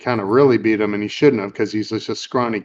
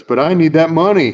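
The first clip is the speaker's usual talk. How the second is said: higher, louder retold plea; pleading protest; 6 semitones higher, 6 dB louder than usual. Peter needs the money for the girl, protesting. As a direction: imitate an aggrieved demand for pay.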